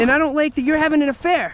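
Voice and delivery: high, loud and fast; angry rapid retort. Acted. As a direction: fire it straight back. Quarrel about the affair.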